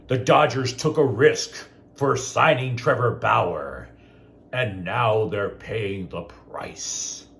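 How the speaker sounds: slow, put-on pompous quote; mocking read-out. Host quoting a newspaper column, slow and mocking. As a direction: read it out slowly, mocking the opinion.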